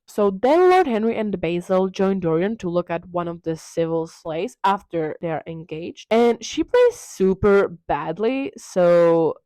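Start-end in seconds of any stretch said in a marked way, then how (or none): none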